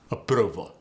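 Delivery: Approval with a short staccato oo; Arabic accent Approval. Shown as a demonstration. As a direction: clip the middle vowel of Approval. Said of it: "spoon and approval go to".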